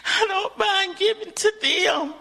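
crying, voice up high; tearful. Real, not acted. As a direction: let the tears come through. Emotional testimony about giving.

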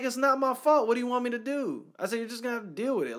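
high, loud angry yelling; retold yelling. Telling a friend how he yelled at the store lady, a high loud shout. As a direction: shout back at her. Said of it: "I started yelling".

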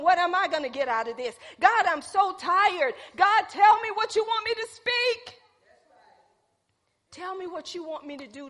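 loud, high cry to God; a tired plea rising to a shout; pleading prayer. Retold or imitated. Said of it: "voiced prayer".

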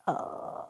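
low-energy sighing groan; breath of mock defeat; helpless groan. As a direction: let out a soft, defeated "uh". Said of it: "And I'm like".

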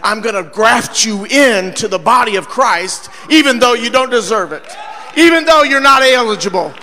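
loud, fervent preacher cadence; preaching at full force. Acted. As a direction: preach it out to the congregation.

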